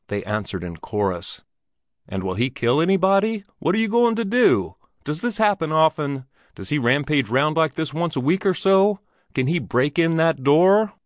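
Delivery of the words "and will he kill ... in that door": panicky questions. Acted. Frightened drummer's rush of questions.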